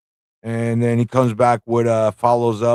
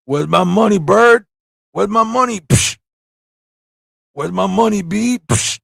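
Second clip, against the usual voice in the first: pimp voice; 8 semitones higher than usual. Acted. Jitsu shaking down Stratos for money, hammed-up and higher. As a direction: lean into the demanding pimp. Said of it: "Let me do it again".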